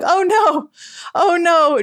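high, hammed-up "oh no" swoon; dramatic fainting lament. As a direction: swoon like an old-timey movie heroine.

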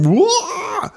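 high yelp of surprise, laughing; startled whoa. Retold or imitated. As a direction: jump at the sight, laughing. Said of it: "And he's like".

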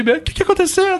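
high worried grandmother, lightly voiced; grandma voice. Grandmother rushing to the crying grandson, high and worried. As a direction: play the alarmed granny. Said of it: "minha avó veio".

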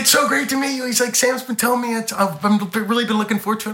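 high-pitched friendly gush; effusive greeting. Retold or imitated. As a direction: imitate a star suddenly turning sweet. Acted star greeting with a hug.

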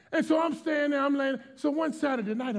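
ordinary talk, nothing marked

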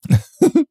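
high-pitched burst of laughter. Real, not acted. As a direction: let out a high squeal of a laugh. Laughing at own story.

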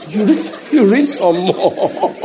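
laughing through the words, slowed; chuckling while finishing the line. Real, not acted. Preacher to congregation, laughing mid-sentence.

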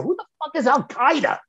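high-pitched incredulous question; baffled outburst. Retold or imitated. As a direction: voice shoots up in disbelief. Retold bafflement at unknown name.

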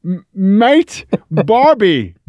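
high-pitched, clumsily faked Australian; bad Australian accent. Co-host who is bad at accents trying again, badly faked Australian accent. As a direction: stab at an Australian accent in halting bursts. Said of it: "I'm bad at accents".

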